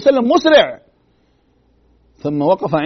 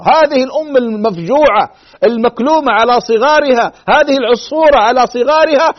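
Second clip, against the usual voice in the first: louder, higher sermon build; impassioned preaching; 4 semitones higher, 5 dB louder than usual. Acted. Preacher moved by the bird's grief, raised and louder. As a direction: pour feeling into the grieving mother bird.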